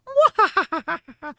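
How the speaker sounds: very high squealing cackle; anime laugh. Retold or imitated. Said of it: "That's also a laugh".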